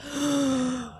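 a soft shocked intake of breath; sharp gasp. Retold or imitated. Reliving the lost tarpon, soft shocked gasp. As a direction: gasp as the fish breaks off. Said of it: "And I was like".

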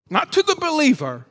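usual speaking voice

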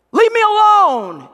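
high, loud, drawn-out protest; upset shout. Acted. Upset listener pushing God away, shouting. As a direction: shout it like someone fed up.

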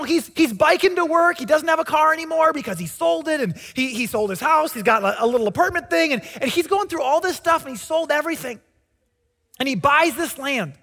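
normal talking voice

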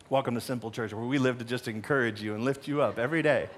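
low, dry sarcastic aside; deadpan joke, tongue in cheek. Acted. Sarcastic aside after grim line.

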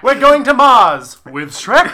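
loud, high, drawn-out ride announcement; hammy theme-park ride call; mock ride announcer. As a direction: announce the blast-off with a laugh. Acts out imagined ride announcement.

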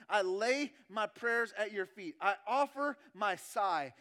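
slow, insistent list with strong beats; emphatic preaching litany. Acted. Preacher voicing David's offering to God, insistent preaching litany. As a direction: lay down each item with force.

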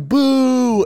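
high, drawn-out retold boo; mock boo. Spectator booing the bad-guy wrestler, mock heckle.

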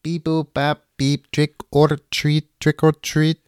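robot with a mock Russian accent. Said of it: "Why is there a Russian robot".

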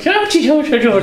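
put-on over-the-top Irish accent; full-on Irish. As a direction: ham up a thick Irish accent calling Georgie. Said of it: "like, full on".

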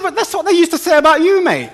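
a cheeky Cockney comeback to a bus-stop grumbler; Cockney accent. Retold or imitated. Preacher retorting to an old man in mock Cockney. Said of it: "I thought I'd give him my Cockney accent".

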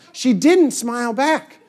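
normal talking voice

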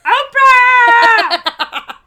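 high shrieking squeal of love; hammed-up gushing shout; mock fangirl scream. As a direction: scream it like a starstruck fan. Mock-fan squeal at Oprah.

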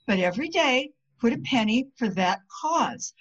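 ordinary delivery

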